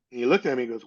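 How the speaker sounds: normal talking voice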